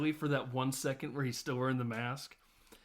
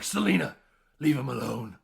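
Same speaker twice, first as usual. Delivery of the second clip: loud, mock-stern intervention; Batman; 6 dB louder than usual. Stepping in to stop Selina, loud mock command. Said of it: "Batman to intervene and be like".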